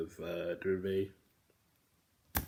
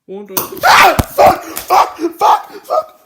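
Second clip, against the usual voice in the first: screamed curses, very high and loud; screaming; 33 dB louder, much slower than usual. Pretend. Screaming outburst.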